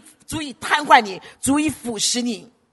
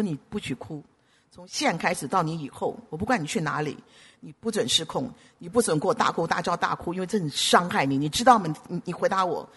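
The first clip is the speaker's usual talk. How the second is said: low, softer retold order; quiet firm command; 5 semitones lower, 5 dB quieter than usual. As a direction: lay down the rule quietly. Retells firmly ordering crying woman. (低声而坚定地命令)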